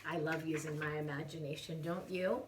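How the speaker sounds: ordinary delivery, nothing marked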